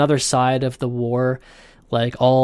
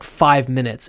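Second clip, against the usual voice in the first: ordinary talk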